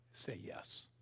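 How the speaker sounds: whispered gentle plea; hushed urging. Real, not acted. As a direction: breathe it out softly.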